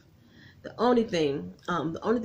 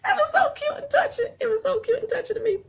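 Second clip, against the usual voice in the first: high, swooning sweet voice; real swoon at a touching scene; gushing. Real, not acted. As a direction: melt over how cute it is. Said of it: "I was like".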